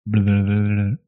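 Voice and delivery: droned blah blah as a game-show buzzer; mock buzzer. Ian buzzing in to answer, a mock buzzer drone. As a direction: buzz in with a silly droning blah. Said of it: "That's my buzzer".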